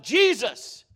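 "Jesus!" cried out loud and high; called out. Retold or imitated. Preacher reliving the moment he cried out, loud cry. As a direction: shout the name without thinking. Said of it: "I called out".

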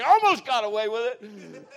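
loud, high, laughing cheeky admission; laughing confession. Retold or imitated. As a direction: giggle it out like a caught sister.

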